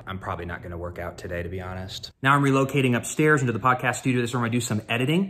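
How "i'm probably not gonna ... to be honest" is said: softer quick confession; quiet aside. Real, not acted. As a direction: mutter the honest truth. Quiet honest correction.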